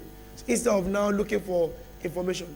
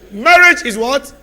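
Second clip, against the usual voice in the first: loud, rising preacher calls with long pauses; preaching at full force; 3 semitones higher, 8 dB louder than usual. Acted. Preacher rousing the church with loud calls. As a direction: pound each phrase out to the church.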